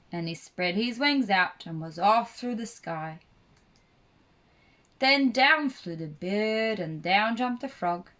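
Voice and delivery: ordinary delivery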